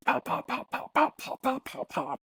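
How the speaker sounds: rapid high popping gunfire noise; imitated gunshots. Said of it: "she hears the gunshots".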